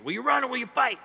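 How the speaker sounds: milder William Wallace. Acted. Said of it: "William Wallace type voice".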